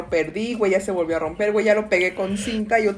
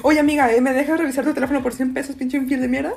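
louder, higher, fast mocking taunt; mock confrontation; 5 semitones higher, 4 dB louder, faster than usual. Podcaster acts out the meme guy taunting the cheater, loud and fast.

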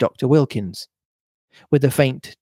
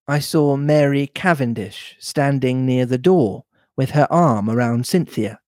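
ordinary delivery

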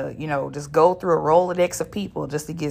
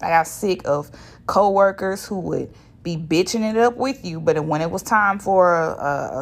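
ordinary talk

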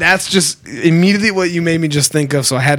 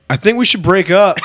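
raised, louder mock call; calling across the street; 4 semitones higher, 5 dB louder than usual. Man in his driveway to screaming girlfriend, calling out. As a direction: call it out to someone far off. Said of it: "From across the street".

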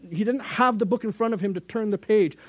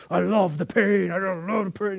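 mock gym bro. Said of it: "that psycho joy of that guy who's looking at himself in the mirror".

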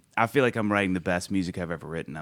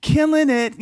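high, loud cheer; hyped-up praise; 9 dB louder, slower than usual. Retold or imitated. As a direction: hype him up like a fan. Crowd praising young preacher.

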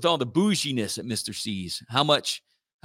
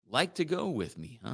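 much softer, gentle echo that asks back; sympathetic questioning echo; 8 dB quieter than usual. Shown as a demonstration. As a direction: mirror the last words with quiet interest. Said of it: "You repeat the last two or three words?".

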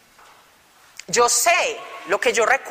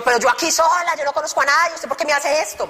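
indignant complaint; 6 semitones higher than usual. Retold or imitated. Paola reproaching the brother on the phone, higher. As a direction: scold him for leaving her alone.

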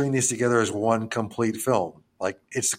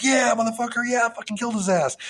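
much higher, hammed-up cocky crowing; mock gloating; 13 semitones higher than usual. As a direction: brag like a dumb action guy. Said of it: "is like".

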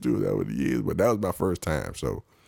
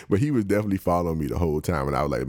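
ordinary talk

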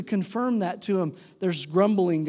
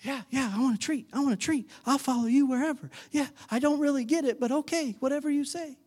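high, simple-minded doggy voice; eager pet dog; 7 semitones higher, faster than usual. Acted. Disciples answering like a dog wanting a treat, high-pitched. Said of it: "And we're like".

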